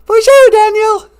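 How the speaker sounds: very high cooing voice; high pet voice. Acted. A squeaky cooing voice praising the host's intro. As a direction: coo it like baby talk.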